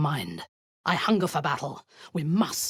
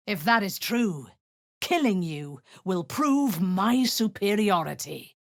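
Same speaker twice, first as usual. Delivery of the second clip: ordinary delivery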